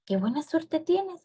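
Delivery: "Qué buena suerte tienes" as a high, doubting question; questioning intonation. Shown as a demonstration. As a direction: ask "Qué buena suerte tienes" with pitch lifted through "tienes". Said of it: "yo le puedo estar preguntando también".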